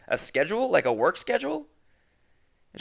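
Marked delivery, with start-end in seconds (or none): 0.1-1.7 retold puzzled question. Retelling own puzzled reply.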